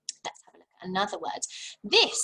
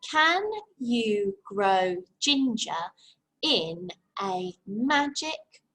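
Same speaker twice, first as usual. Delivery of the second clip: very slow, one beat per word; tapping the syllables; 2 semitones lower, much slower than usual. Shown as a demonstration. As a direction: tap out each word slowly. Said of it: "Tap it on your head".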